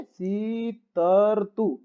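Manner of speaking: सीतरतु stretched out very slowly; slow sounding out. Shown as a demonstration. Teacher slowly sounding out सीतरतु again. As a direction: spell out सीतरतु aloud, slowly. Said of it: "र आधा है तो सी".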